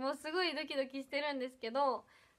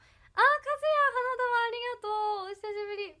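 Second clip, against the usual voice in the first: excited; 9 semitones higher, 5 dB louder than usual. Real, not acted. Fan sends flowers, calls out.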